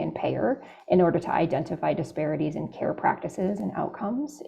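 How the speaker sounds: ordinary talk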